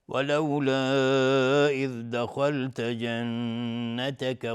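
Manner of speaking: slow chanted tajweed recitation; Quran recitation. Shown as a demonstration. Teacher reciting the verse for viewers, slow melodic Quran recitation. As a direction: draw out the long vowels in a measured melodic chant.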